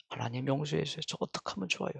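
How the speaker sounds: normal talking voice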